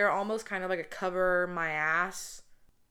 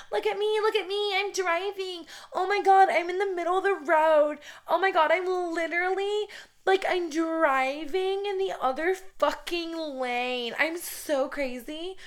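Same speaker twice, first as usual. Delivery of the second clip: high, loud attention-seeking mock voice; mocking a giddy show-off, pitched way up; Debbie Ryan; 11 semitones higher, 6 dB louder than usual. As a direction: squeal it like a hammed-up show-off. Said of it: "the Debbie Ryan".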